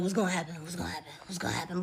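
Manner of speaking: hushed, imitated waiting to see; anxious anticipation. Leon watching her eat the pastries, hushed anticipation. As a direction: mutter it low under your breath, watching.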